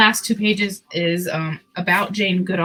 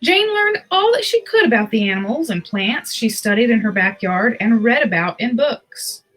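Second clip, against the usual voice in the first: put-on reading a picture book to children; story narration. A narrator's reading. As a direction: tell it gently to little listeners.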